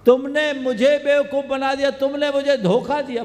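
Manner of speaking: high, loud, fast accusation; acted indignation. Tricked suitor accuses the girl, indignant.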